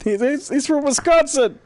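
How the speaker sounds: high, rising cry of exposure; mock accusing shout. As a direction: denounce the impostor to a crowd. Acted mob exposing the fake Brit.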